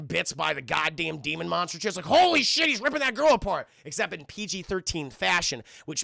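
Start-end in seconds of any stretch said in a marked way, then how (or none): 2.0-3.7 high, loud mock shock; horrified reaction. Retelling her horror at the girl's fate, loud mock shock. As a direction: exclaim it in alarm, hammed-up.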